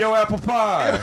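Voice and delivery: hammed-up call across the room; mock shout-out. Imitating the guys calling out.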